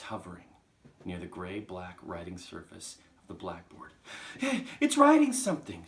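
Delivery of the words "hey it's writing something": very high, excited schoolboy shriek; screamed alarm. Acted. Nigel alerting the class to the chalk, screaming. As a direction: shriek it out in amazement. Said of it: "screamed Nigel".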